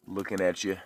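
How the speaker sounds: ordinary talk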